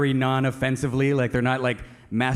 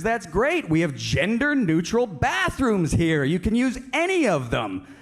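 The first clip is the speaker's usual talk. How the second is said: lightly hammed-up enthusiasm, pitched higher; eager bartender; 7 semitones higher than usual. Bartender offers gender-neutral bathrooms, higher mock-eager welcome. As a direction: lift the pitch, eagerly obliging.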